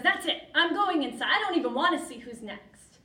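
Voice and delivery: loud, high, fed-up outburst; acted indignation at full voice; exasperated. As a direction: storm off in a huff. Martina gives up in a huff.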